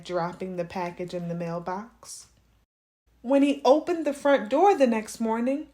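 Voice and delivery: plain reading voice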